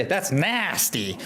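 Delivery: slow American "nasty" with a flat a as in cat; American accent, slowed and drawn out. Shown as a demonstration. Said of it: "But American will say".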